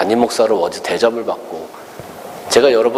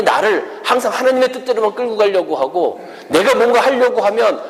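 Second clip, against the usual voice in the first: higher, heated protest building to a peak; angry Jonah; 7 semitones higher than usual. Acted. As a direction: rant at God like a sulking prophet. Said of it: "요나가 하나님께 막 그렇게 화를 냈어요". (하나님께 화내는 요나, 높아지는 항변)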